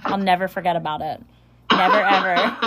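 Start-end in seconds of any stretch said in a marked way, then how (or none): 1.7-2.6 said through real laughter; amused, breaking into laughter; laughing. Real, not acted. Laughing over shared deck memory.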